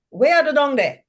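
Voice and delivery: Jamaican lilt, falling at the end; demonstrating Jamaican speech to a dialect panel, lilting Jamaican; Jamaican intonation. Shown as a demonstration. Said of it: "That's what we say".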